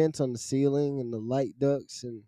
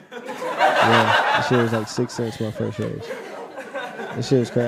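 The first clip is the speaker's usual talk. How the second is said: laughing; 2 semitones lower, slower than usual. Real, not acted. Laughing about six-cent raise.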